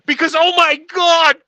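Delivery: high, loud exasperated outburst; yelling. Real, not acted. Movie hater giving his disclaimer, exasperated yelling. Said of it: "I'm yelling down here".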